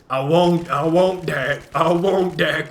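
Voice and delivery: loud, slow straining protest; urgent shouting at teammate Dak; loud frantic. Real, not acted. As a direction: push it out loud as the fight turns.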